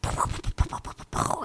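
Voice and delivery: soft unvoiced pow pow gunfire sounds; whispered gunfire. Friend retelling a movie battle, whispered gunfire sounds. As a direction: mouth the shots in a hushed puff. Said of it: "they're like".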